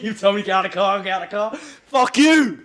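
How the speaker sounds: loud, high shouted retort, building to a yell; reliving the row, explode into a high yell; heated outburst. Retold or imitated. Re-enacting his row with the trainer at the car, heated shouting. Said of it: "sorry, I'm getting a bit heated".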